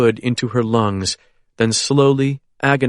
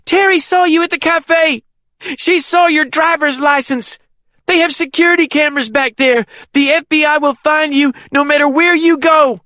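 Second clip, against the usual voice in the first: high, loud pained shouting; 5 dB louder than usual. Acted. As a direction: keep yelling through agony. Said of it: "but she kept shouting".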